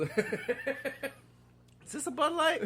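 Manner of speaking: laughing mock question; laughing jab. Real, not acted. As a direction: tease the beer, cracking up.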